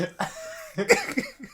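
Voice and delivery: very high squeal of laughter; very high shriek of laughter. Real, not acted.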